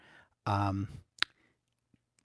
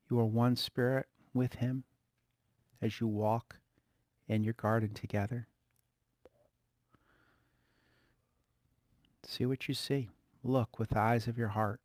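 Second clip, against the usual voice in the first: guided meditation; 7 dB quieter than usual. Acted. Leads a soft guided meditation.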